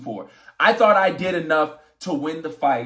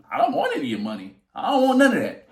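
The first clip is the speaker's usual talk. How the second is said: usual speaking voice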